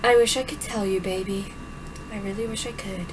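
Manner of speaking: low, hushed and tender; soft regretful mother. Acted. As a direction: comfort her gently.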